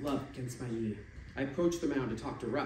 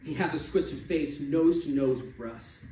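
ordinary delivery, nothing marked

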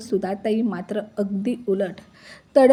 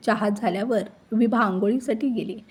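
ordinary delivery

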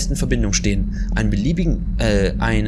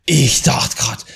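startled whisper; 4 dB louder, much slower than usual. Real, not acted. Startled by figure.

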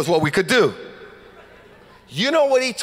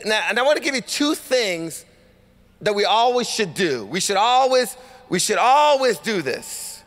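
ordinary delivery

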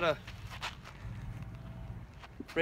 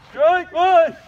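umpire shout; 9 semitones higher, 12 dB louder than usual. Acted. Mock umpire call, drawn out.

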